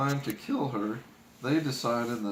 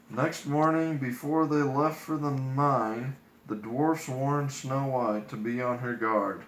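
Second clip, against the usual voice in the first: usual speaking voice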